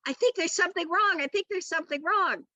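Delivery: high fretful mother voice; lightly voiced anxious mother; mother's worried voice. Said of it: "and goes".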